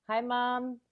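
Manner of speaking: usual speaking voice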